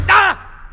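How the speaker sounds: high, loud cry of rage; frustrated yell. Acted. Prisoner raging at his guard, high frustrated yell. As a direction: burst out with an exasperated shout. Caged outlaw loses his temper.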